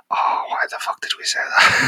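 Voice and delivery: unvoiced whisper; whispering embarrassment at themselves; whispered regret. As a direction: hush it like a regretful aside. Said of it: "we're gonna be like".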